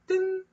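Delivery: high vocal ding chime; ding sound effect. Acted. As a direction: sing a quiz bell ding. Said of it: "첫 번째 문제입니다".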